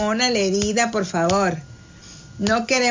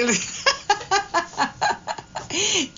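laughter; 8 semitones higher, 4 dB quieter than usual. Real, not acted. DJ laughs after sighing "ay.

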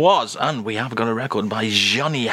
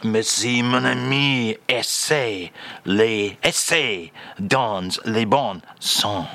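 put-on French accent; 2 semitones lower, slower than usual. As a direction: savour each word in mock French. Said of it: "Again, [name], if you will".

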